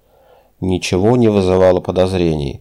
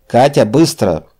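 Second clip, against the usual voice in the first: shouting into intercom; 4 semitones higher, 4 dB louder than usual. A narrator's reading. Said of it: "крикнул полковник в селектор".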